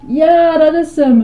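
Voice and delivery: high, loud cry of delight; joyful shout. A narrator's reading. Girl hears her flavour at last, joyful shout. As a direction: shout it out, overjoyed. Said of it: "riep Betty".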